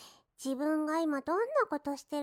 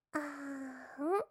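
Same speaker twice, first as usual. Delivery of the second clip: coaxing; 6 dB quieter, much slower than usual. Acted. Feeding the master.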